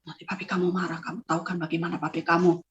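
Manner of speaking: low, soft coaxing; hushed urging. Retold or imitated. Mother calming child before father angers, hushed.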